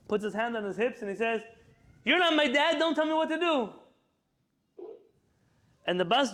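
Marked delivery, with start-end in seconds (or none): 2.0-3.7 put-on high, loud bratty kid voice; a defiant little kid talking back, pitched up; cheeky kid. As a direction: sass the driver, hands on hips. Cheeky Israeli kid answers back.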